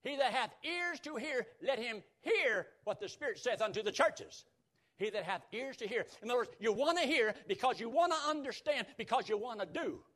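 high, driving preacher delivery; emphatic preaching. Real, not acted. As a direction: preach it with rising force.

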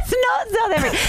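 high-pitched, incredulous cry; amused shriek of objection; laughing protest. Real, not acted. Laughing protest at bad accent.

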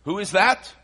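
usual speaking voice

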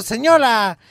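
hammed-up doctor greeting; doctor answering. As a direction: greet her with exaggerated concern. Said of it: "Entonces yo le digo".

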